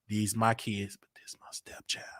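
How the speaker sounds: hushed imitation of a parent playing favourites; mock whisper. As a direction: breathe it like a secret aside.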